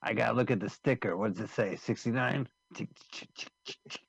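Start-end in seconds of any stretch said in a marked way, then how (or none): 2.7-4.0 whispered ticking register keys; typewriter sounds. Retold or imitated. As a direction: tap out tick sounds softly. Imitating a cash register.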